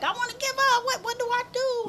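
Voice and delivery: high-pitched whimpering plea, retold; imitating her crying. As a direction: mimic yourself sobbing to your husband on the phone.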